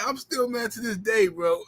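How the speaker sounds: ordinary talk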